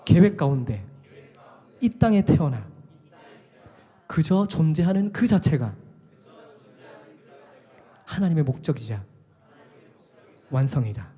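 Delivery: loud, slow preached line for the congregation to repeat; loud preacher's lead line; call and response. Shown as a demonstration. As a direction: proclaim it slowly, phrase by phrase, for them to echo. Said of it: "한번 따라하십시오".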